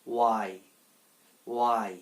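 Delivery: "ওয়াই" as a short "wai", no h; silent h. Shown as a demonstration. Teacher giving learners the correct form of why, "ওয়াই" with silent h. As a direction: open "ওয়াই" straight on a w. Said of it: "এখানে সঠিক উচ্চারণটা হচ্ছে".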